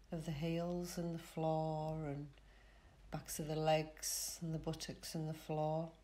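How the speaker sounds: calm relaxation guide with long pauses; soothing Yoga Nidra voice, spaced phrase by phrase; guided meditation. Real, not acted. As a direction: lead them gently into rest, unhurried.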